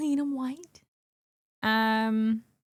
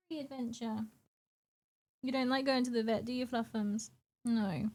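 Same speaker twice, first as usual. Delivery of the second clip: much softer, cooing to the cat; soft pet talk; 8 dB quieter than usual. Real, not acted. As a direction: coo gently to a scared cat. Talking softly to her cat.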